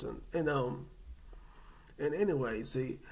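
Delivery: ordinary talk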